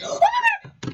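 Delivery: high squeal of shock, gasping first; gasp, then shriek it out. Real, not acted. Vlogger reacting to her purchase with a high shocked gasp.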